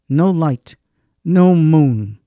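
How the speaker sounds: ordinary delivery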